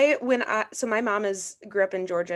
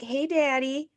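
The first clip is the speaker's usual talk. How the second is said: put-on high little-kid Southern drawl; Southern accent; 7 semitones higher, slower than usual. Child phoning Dad from Georgia, new Southern accent. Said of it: "had this Southern accent".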